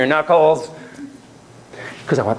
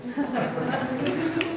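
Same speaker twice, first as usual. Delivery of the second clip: soft high laugh; 12 semitones higher, 10 dB quieter than usual. Real, not acted. Laugh at her coy remark.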